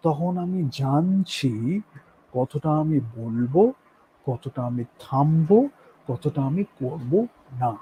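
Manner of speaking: slowed-down demo of controlled pacing; measured pacing. Shown as a demonstration. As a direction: weigh each phrase, then pause. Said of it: "পেসিং, এটা আমি লাস্টে একটু দেখাচ্ছি".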